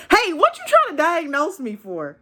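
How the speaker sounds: high, indignant mock retort; defensive comeback. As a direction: push back like she was insulted.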